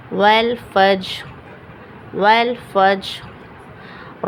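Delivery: والفجر very slow, final ر stopped but lightly sounded. Shown as a demonstration. Teacher recites والفجر slowly so students hear the faint ر. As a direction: draw out والفجر and let its ر ring just faintly. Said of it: "را کی آواز نکلے گی لیکن معمولی".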